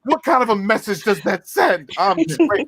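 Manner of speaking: high-pitched mock indignation; hammed-up triggered complaint; mock outrage. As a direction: play offended, pitched well up. Mocking outrage at the scene just described.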